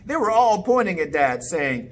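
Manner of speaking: ordinary delivery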